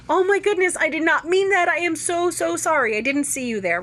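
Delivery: high, startled, gushing apology; flustered apology. Retold or imitated. A driver apologizing to the one she cut off, flustered and high. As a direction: blurt it out, mortified at cutting someone off. Acted apology after cutting someone off.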